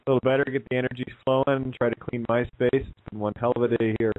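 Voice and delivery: ordinary delivery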